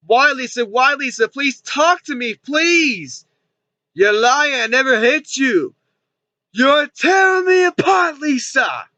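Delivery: high, drawn-out anguished pleading; hammed-up movie heartbreak building to a shout; mock melodrama. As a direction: plead slowly, then wail it out theatrically. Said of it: "That's some dramatic lines".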